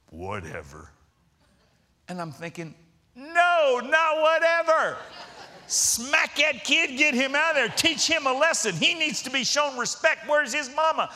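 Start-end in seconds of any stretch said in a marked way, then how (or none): 0.1-0.9 much lower and softer, letting it go; low resigned whatever. Retold or imitated. Big man gives up on the kid, low and soft. 3.1-11.1 higher, louder mock outrage; mock indignant. Pastor inwardly wants the kid punished, mock outraged.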